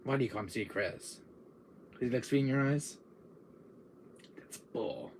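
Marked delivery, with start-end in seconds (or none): none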